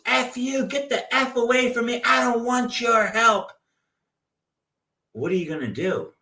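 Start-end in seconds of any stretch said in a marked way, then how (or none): 0.1-3.5 much higher, hammed-up angry rant; pretend cussing. Crash victim cussing out a good Samaritan in mock anger. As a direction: shoo away the helper in a mock rage. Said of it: "they start cussing".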